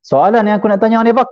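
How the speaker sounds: high-pitched, hectoring questions; imitating a harsh interrogator; rough, bullying demand. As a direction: press the question as if intimidating. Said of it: "Kasar. Ger-, ada gertak. Keras".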